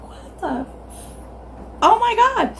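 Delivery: high, panicked disbelief; shocked. Retold or imitated. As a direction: gasp it out in shock. Gear shift comes out in hand.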